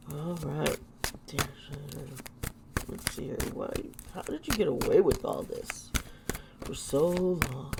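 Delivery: soft, low muttered question; quiet muttering. Real, not acted. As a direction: mutter it to yourself. Muttering to herself over the cards.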